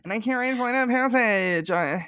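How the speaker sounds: high, put-on pleading whine; whiny pleading guest. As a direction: beg sweetly for a favor. Mock pleading guest at Disney.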